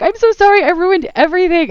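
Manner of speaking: high, loud, near-sobbing apology; retelling bursting into tears at the party; tearful apology. Retold or imitated. To the game director at the party, tearful and pitched high. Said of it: "I burst into tears".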